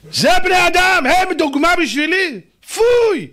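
high, loud outraged preaching; outraged shout. Real, not acted. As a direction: thunder it out in disgust. (זעקה נרגשת)

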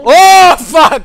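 huge high shriek; real panicked yell; terrified scream. Real, not acted. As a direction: scream at the jump scare. Jump scare hits.

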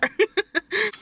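bright burst of laughter; a quick giggle at herself; short laugh. Real, not acted.

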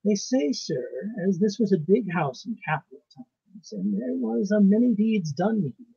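put-on slow, heavy, deliberate old housekeeper; with an effort. A narrator's reading. Housekeeper forcing out a dark secret to her master, slow and effortful. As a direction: drag each word out as if it costs her. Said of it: "said with a sort of effort".

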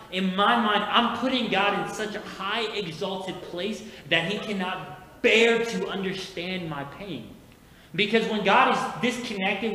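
loud, high, preaching at full force; building sermon. Acted. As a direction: preach it, rising in intensity.

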